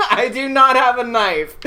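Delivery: high, loud, through laughter; giggly, over-firm denial; emphatic denial. Retold or imitated. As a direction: insist on it while cracking up.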